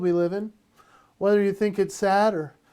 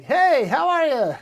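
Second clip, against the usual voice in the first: slow, high greeting breaking into laughter; laughing greeting; 8 semitones higher, 4 dB louder than usual. Pretend. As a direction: try to greet but crack up. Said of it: "I'll just walk up like".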